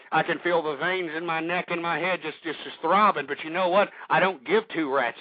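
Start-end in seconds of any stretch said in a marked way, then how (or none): none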